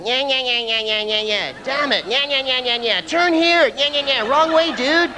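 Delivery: high, whining American yammer; squawking American tourist giving directions; mock American accent. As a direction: caricature an American as high-pitched gibberish. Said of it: "I must sound like this to them".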